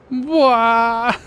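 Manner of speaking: loud high wailing cry; fake crying wail. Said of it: "See, now he's crying".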